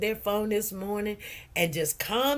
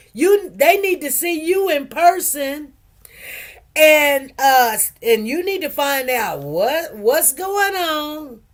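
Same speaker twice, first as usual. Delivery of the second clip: loud excited advice from a friend; 5 semitones higher, 6 dB louder than usual. Retold or imitated. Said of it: "She said".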